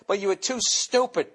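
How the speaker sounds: ordinary talk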